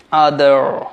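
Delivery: other ending in a clear r; American pronunciation. Shown as a demonstration. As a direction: say other as "aa-derr". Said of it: "American অ্যাকসেন্টে বলবেন".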